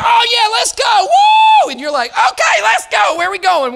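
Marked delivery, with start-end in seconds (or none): 0.0-1.7 high, loud whooping cheer; hyped cheer. Retold or imitated. Excited friend hyping everyone up. 2.1-3.8 caught-up cheer. Retold or imitated. Going along with excited friend.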